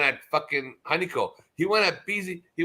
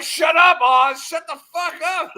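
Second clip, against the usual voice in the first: screamed much higher and louder; furious shout; 12 semitones higher, 8 dB louder, slower than usual. Retold or imitated. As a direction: yell him down. Yelling at Oz in chat.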